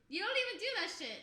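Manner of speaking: loud, high, rapid complaint; exasperated outburst. Real, not acted. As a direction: fire off the grievance in one rush. Rant resumes after the acted exchange.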